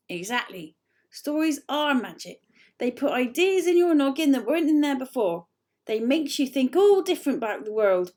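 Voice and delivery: loud grumpy growl; grumpy bark. A narrator's reading. Grunt scolding Lucy about stories, loud and grumpy. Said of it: "barked Grunt grumpily".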